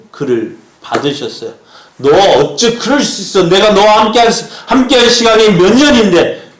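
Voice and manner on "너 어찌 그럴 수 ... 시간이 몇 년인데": loud, high, hurt-sounding rebuke; indignant scolding, voice pitched right up; acted reproach. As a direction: reproach a trusted friend at raised volume. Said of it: "베드로를 책망하지 아니하시고".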